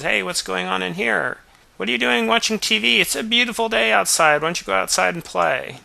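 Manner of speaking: put-on chirpy mom shooing her kid outside; mother pestering her son to go play. Mother pokes in, scolds boy.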